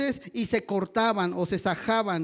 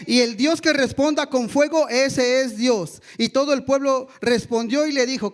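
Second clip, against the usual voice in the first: preaching at full force; 2 semitones higher, 5 dB louder than usual. Acted. Pastor proclaims the fire test to the congregation, loud. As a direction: ring it out over the church.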